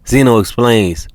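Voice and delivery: plain reading voice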